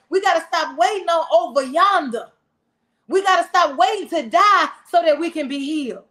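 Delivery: preaching at full force. Acted. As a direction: drive the point home to the pews.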